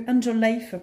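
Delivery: English with a French accent; accent anglais pourri. Real, not acted. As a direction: pronounce the English like a native French speaker. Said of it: "j'ai un accent anglais pourri". (accent français en anglais)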